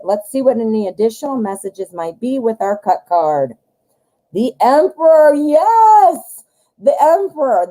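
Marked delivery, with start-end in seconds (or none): 4.3-7.7 high, loud, thrilled cry; triumphant, drawn-out high exclamation; real excitement. Real, not acted. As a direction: exclaim it in delight at the card. Delighted reaction to card drawn.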